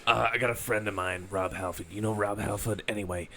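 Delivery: low, casual name drop; name-dropping wrestler impression. Smug name-dropping to the boys, casual and low. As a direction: drop the name like it's nothing. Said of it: "he always does the name".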